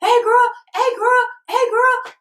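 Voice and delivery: high, loud mock catcall; boys catcalling. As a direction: holler it after the girls as they leave. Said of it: "those little boys still".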